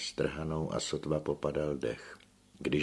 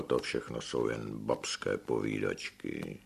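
posměšně; 6 semitones lower, 5 dB quieter than usual. A narrator's reading. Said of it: "s úsměškem prohlašoval".

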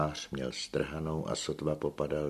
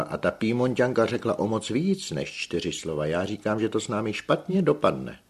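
křik; 6 semitones higher, 4 dB louder than usual. A narrator's reading. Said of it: "Amélie křičela".

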